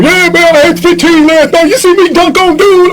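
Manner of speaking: high, cocky boasting voice; bragging roommate. Retold or imitated. Roommate brags to benched friend, high and cocky. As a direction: show off your game.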